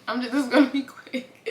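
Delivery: fast, laughing through a catch of tears; teary rush. Real, not acted. Rushing to finish before crying again, fast teary laugh. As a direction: hurry the words out before you cry. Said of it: "before I start crying again".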